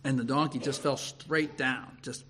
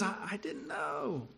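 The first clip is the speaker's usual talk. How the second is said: soft, high, slow stammer; sheepish excuse; 7 semitones higher, 11 dB quieter than usual. Acted. Balaam faces the angel in his way, meek stammered excuse. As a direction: plead ignorance in a small drawn-out voice.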